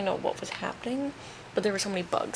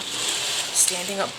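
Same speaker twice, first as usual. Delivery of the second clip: usual speaking voice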